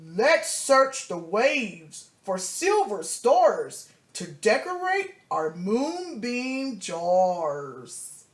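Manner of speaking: a light pirate voice for a bath-time game; deep pirate voice. Retold or imitated. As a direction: play mom hamming up a pirate for her child. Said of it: "says in a deep voice".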